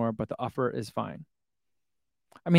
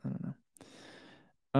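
ordinary delivery

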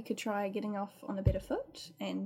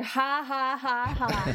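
loud, higher mock ha-ha; staged laughter; 5 semitones higher, 6 dB louder, much slower than usual. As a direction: belt out a fake, mocking laugh. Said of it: "And start going".

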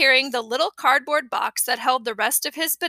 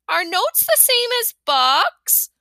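put-on curious kid; 7 semitones higher, slower than usual. A narrator's reading. Fudge asking.

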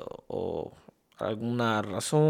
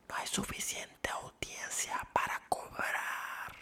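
slow, very soft whisper; mock confidential whisper; 15 dB quieter than usual. Host confiding the show's money trouble to listeners, mock whisper. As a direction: whisper it slowly, as if letting out a secret.

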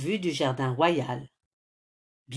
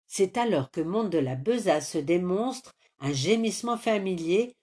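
plain reading voice

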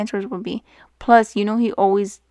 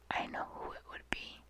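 whisper; 21 dB quieter than usual. Real, not acted. Dark confession to friend.

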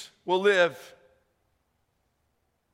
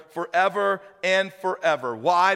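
ordinary talk

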